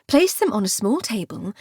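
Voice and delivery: usual speaking voice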